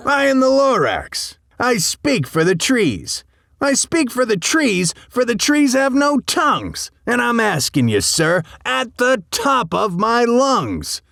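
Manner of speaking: high, bossy little creature voice; sharpish, bossy Lorax. A narrator's reading. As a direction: boss him around at a high pitch. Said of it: "a voice that was sharpish and bossy".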